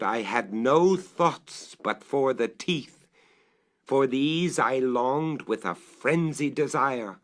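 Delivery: slow and deliberate, pressing each word; slow, swelling frenzy; slow, intense obsession. Acted. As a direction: linger on the words like a craving. Narrator's frenzied desire.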